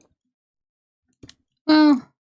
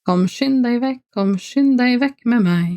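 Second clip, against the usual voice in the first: clean standard Norwegian, pitched higher; 4 semitones higher than usual. Shown as a demonstration. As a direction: read it in proper book Norwegian. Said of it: "more normal Norwegian would be".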